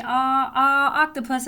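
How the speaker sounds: loud, high short ah sound for octopus; wrong short o sound. Shown as a demonstration. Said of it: "I don't want it to say".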